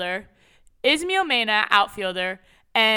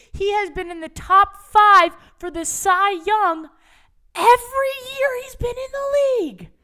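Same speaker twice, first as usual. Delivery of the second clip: rising high, slow, louder rant; exasperated rant; 7 semitones higher, 4 dB louder than usual. Retold or imitated. Lecturing a Padres-fan teammate, climbing high and exasperated. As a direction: build it up higher until it almost squeaks. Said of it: "I go".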